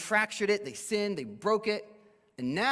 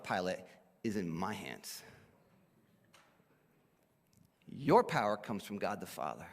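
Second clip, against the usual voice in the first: acted serene rebuttal; calm correction; 8 semitones lower than usual. Acting Jesus calmly correcting Pilate.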